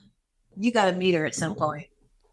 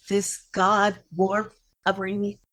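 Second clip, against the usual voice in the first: normal talking voice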